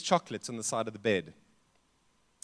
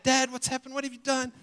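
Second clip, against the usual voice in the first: much higher, alarmed shout at dad; retold shout; 10 semitones higher than usual. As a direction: call out in shock, pitch jumping up. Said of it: "he shouted at his dad".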